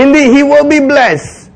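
high-pitched solemn declaration; Isaac declaring. Acted. Isaac declaring Jacob's blessing, high solemn voice. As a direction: announce it like an old patriarch.

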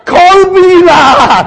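very high, slow, hammed-up yell; comic Singlish shout. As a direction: holler it as a Singaporean Peter. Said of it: "Peter said to Jesus".

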